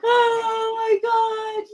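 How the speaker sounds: high, loud helpless laughing; crying with laughter. Real, not acted. To friends mocking her past, crying with laughter. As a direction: laugh till you cry. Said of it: "I'm crying".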